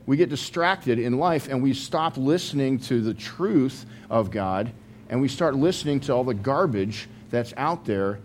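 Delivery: usual speaking voice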